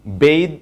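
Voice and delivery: বেইদ said as "bayth", long ay; বেইদ drilled with the long ay vowel; teacher modelling বেইদ for bathe; right pronunciation. Shown as a demonstration. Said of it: "right প্রনাউন্সিয়েশনটা হবে বেইদ".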